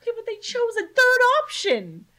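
indignant comic outburst; worked-up protest. Real, not acted. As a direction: protest in high-pitched disbelief.

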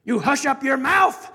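loud, indignant royal rebuke; outraged pompous king; howled. Acted. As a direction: shout down the subject, then boast grandly. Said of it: "howled the mighty King Yertle".

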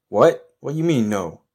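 high, loud, startled protest; indignant disbelief. Acted. Stranded man refused a helicopter ride, incredulous. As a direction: jump up in pitch, affronted.

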